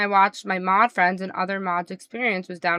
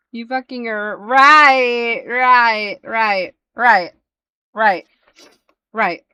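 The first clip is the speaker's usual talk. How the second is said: drawn-out loud repeated right, right; mock yell of disbelief at a streamer; exasperated yell; 4 semitones higher, 9 dB louder, much slower than usual. Retelling her reaction to the streamer, a loud drawn-out mock yell.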